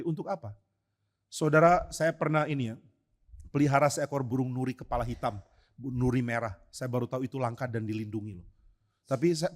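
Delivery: normal talking voice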